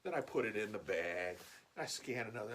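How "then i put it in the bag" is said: ordinary delivery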